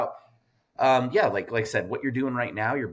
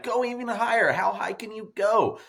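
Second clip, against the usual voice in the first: hyped-up egging on; 10 semitones higher than usual. Pretend. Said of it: "I feel like LeBron James".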